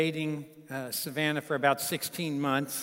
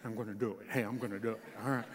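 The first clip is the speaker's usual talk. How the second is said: lower, softer mock-stubborn voice; determined young man; 5 semitones lower, 9 dB quieter, much faster than usual. Son-in-law vowing to his in-laws to shout the proposal, in a low, soft mock-stubborn voice. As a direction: imitate a young man set on his prank. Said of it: "And Patrick is intent".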